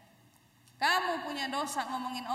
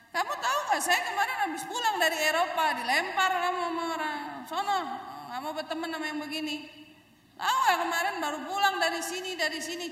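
show-off bragging; 3 semitones higher than usual. Retold or imitated. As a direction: boast to impress, a bit lebay. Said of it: "Ceritanya lebay". (pamer dengan gaya lebay)

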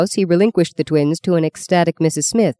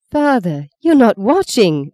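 put-on accusing child; 6 semitones higher, 3 dB louder, slower than usual. A narrator's reading. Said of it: "Maggie accused him".

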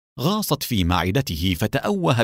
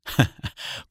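brief, light chuckle; narrated character's small laugh; short laugh; 4 dB quieter than usual. A narrator's reading. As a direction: chuckle briefly before speaking. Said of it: "ضحك أدهم وقال".

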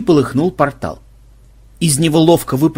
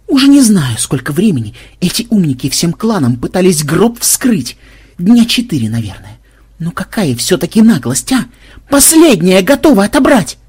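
high, raised aggrieved complaint; indignant rant; 5 semitones higher, 5 dB louder than usual. A narrator's reading. Ксенобайт complains about the clan breaking into his coffin, indignant. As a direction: rail at their cheek, rising to a loud cry.

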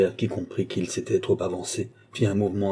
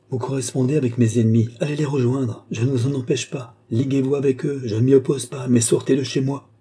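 high, heated string of orders; acted indignant tirade; angry dismissal; 4 semitones higher, 3 dB louder than usual. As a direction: throw him out with mounting insistence. Rousseau angrily orders Gilbert out.

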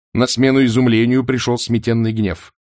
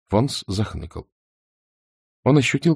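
plain reading voice

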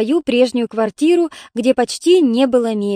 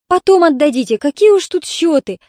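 higher, louder brush-off; dismissive; 5 semitones higher, 4 dB louder than usual. A narrator's reading. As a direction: wave the worry away briskly in the voice. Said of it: "отмахнулась Ольга Петровна".

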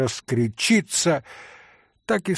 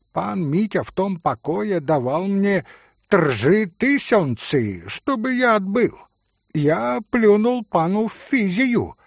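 boastful Polish pan; 7 semitones higher, 4 dB louder than usual. Acted. Пан хвастливо, с польским акцентом.

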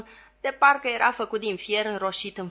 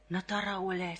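hushed scolding; 7 dB quieter than usual. A narrator's reading. Secretary nudging the foolish helper, hushed. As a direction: scold under your breath. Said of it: "spuse încet secretarul".

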